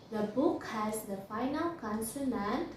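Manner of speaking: usual speaking voice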